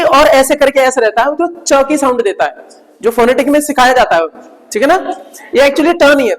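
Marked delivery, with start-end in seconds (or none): none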